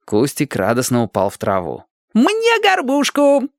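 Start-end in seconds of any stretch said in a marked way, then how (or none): 2.1-3.5 put-on much higher, slow greedy little boy; eager boy voice. A narrator's reading. Boy grabs for the bread crust, high and eager. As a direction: stake your claim like a hungry kid.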